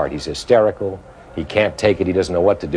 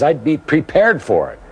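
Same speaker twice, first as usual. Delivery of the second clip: angry retort; 8 semitones higher, slower than usual. Retold or imitated. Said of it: "He's angry".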